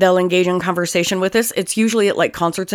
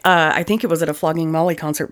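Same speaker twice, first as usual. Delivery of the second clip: ordinary delivery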